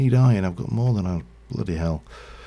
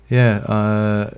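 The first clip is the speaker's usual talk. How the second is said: ordinary talk, nothing marked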